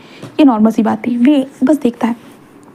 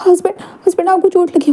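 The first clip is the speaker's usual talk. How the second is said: anxious worry; 6 semitones higher than usual. Acted. Wing sees husband's wound.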